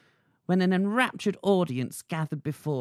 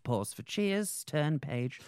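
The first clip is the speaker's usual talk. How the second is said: muttered stage direction; 4 semitones lower, 8 dB quieter, much slower than usual. Acted. Reads stage directions aloud.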